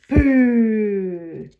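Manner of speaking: loud booming boo. Acted. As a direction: blast out a sudden boo. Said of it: "makes a very loud noise".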